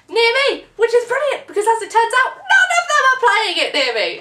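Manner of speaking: high, loud and worked up; excited rant. Real, not acted. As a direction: gush about it, voice up high. Excited rant about venues.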